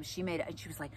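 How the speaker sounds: normal talking voice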